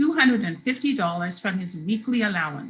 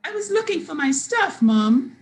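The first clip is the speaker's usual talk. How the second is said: put-on high, protesting child's voice; defensive kid; 8 semitones higher, 4 dB louder than usual. A narrator's reading. Brandon justifying himself to mom, defensive. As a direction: protest high, punching the last word.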